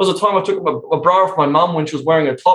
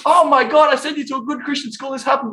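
shocked mum; 11 semitones higher than usual. Retold or imitated. Mum reacting to bra trick, high and shocked.

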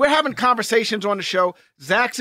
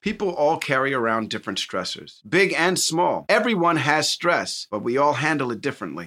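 lower, even sponsor read; 9 semitones lower than usual. Acted. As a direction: read the ad smoothly.

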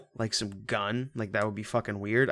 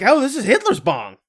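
much higher and louder, hammed-up proud reveal; mock show-off; 13 semitones higher, 9 dB louder than usual. Imagining showing guests the bong, hammed-up proud reveal. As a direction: show it off like a prize, over the top.

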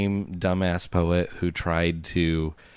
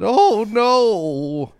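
higher, slow, jittery nervous-guy voice; Don Knotts voice; 7 dB louder than usual. Acted. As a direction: play a bumbling, anxious little man. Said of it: "a Don Knotts kinda guy".

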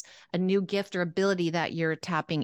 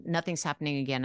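ordinary talk, nothing marked

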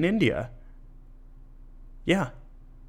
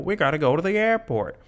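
higher, calling out across the yard; light imitation of a parent yelling; mock yell; 5 semitones higher than usual. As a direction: call out to the family, half-hearted yell. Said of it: "you hear them yell".

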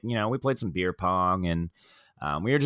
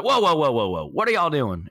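loud, higher North Carolina protest, imitated; North Carolina accent; 5 semitones higher, 4 dB louder than usual. Tailgater stopping the game, North Carolina accent. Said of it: "he continued that North Carolina accent".

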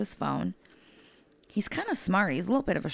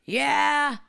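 high, loud drawn-out callback; retold yelled answer; 11 semitones higher, 7 dB louder than usual. Client finally answering the caregiver's screaming, imitated yell. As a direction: call back loudly, imitating the client.

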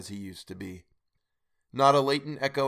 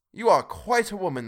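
put-on higher, louder boyish exclaim; 8 semitones higher, 5 dB louder than usual. A narrator's reading. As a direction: marvel at his little sister. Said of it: "exclaimed the boy".

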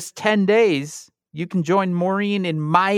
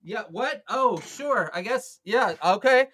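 put-on high, confused old politician fumbling; bumbling Giuliani at the mic; Rudy Giuliani voice; 7 semitones higher than usual.

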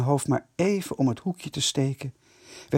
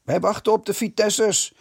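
put-on boys in chorus; 7 semitones higher than usual. A narrator's reading. The boys answer the headmaster together, cheeky. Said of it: "riepen de jongens in koor".

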